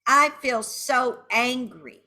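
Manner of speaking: angry venting. Shown as a demonstration. Venting while tapping, emphatically angry. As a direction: lean hard into the feeling. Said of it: "saying angry words now".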